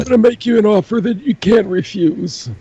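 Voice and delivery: raspy mafia don voice, lightly done; a hint of a mob boss; Godfather impression. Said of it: "Godfather impression".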